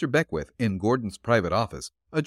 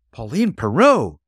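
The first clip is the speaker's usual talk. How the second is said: loud, slow, higher excited outburst; excited cry; 5 semitones higher, 9 dB louder than usual. A narrator's reading. Patsy recognising the missing girl's initials, excited cry. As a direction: blurt the name in sudden excitement. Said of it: "cried Patsy, momentarily excited".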